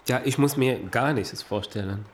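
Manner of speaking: usual speaking voice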